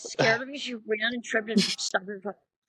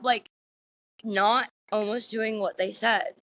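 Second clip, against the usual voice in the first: ordinary talk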